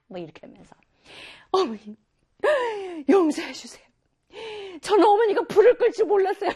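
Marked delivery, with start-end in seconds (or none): 1.5-6.5 high, loud hammed-up pleading son; wrong-take pleading son. Shown as a demonstration. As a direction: ham up a begging son, half laughing. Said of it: "이게 아니고". (웃음 섞인 과장된 애원)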